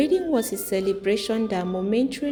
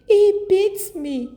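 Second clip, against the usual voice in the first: high, slow, louder wail of hurt; tearful cry; 11 semitones higher, 6 dB louder, slower than usual. Acted. Wife telling the village women of her beatings, high wailing sob. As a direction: let it break into a sob, much higher. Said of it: "She broke down in tears".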